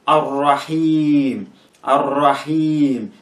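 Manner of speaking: "Ar-Rahim" slowly with a long held ee; teacher's slow "Ar-Rahim", last syllable drawn out; prolonged E. Shown as a demonstration. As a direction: stretch the final vowel of "Ar-Rahim" into Raheeem. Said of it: "that part should be prolonged".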